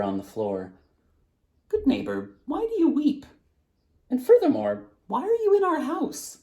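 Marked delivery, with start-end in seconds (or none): 1.7-6.4 high, puzzled and much higher; asking the weeping thief why she's here; high surprised voice. Acted. Family member asks the intruder, high and bewildered.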